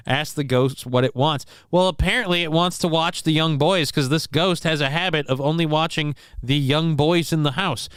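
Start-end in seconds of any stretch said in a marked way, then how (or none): none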